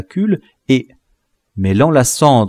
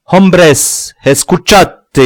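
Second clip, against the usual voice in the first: much louder and higher Spanish cry; 8 semitones higher, 7 dB louder, slower than usual. A narrator's reading. Lobie cries out to the rebels, loud. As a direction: shout to the whole crowd. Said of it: "s'écria Lobie".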